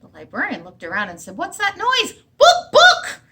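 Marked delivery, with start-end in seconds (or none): 1.4-2.1 puzzled librarian. Acted. Puzzled librarian hears clucking. 2.4-3.2 very high, loud book book clucks; clucking hen. Acted. Hen clucks at the librarian, very high and loud. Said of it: "clucked the hen".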